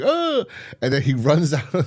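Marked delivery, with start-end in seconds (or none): 0.0-0.5 high mock yelp of fright; scared cry. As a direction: let out a put-on frightened "ah". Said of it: "scared, like".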